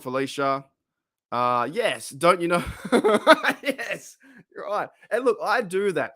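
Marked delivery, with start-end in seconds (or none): 2.7-3.6 quick high burst of laughter. Real, not acted. Host laughing at chat comment, high laugh.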